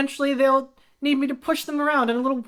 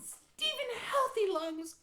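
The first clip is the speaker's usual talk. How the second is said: put-on swooning girl; 7 semitones higher, 14 dB quieter than usual. Young Annabelle, swooning over name.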